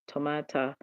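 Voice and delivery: Tomata said softly as toh-MAH-tah; alternative pronunciation. Shown as a demonstration. Streamer testing how she said it, Tomata softly as toh-MAH-tah. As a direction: softly try Tomata with an AH in the middle. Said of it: "That's not how she said it".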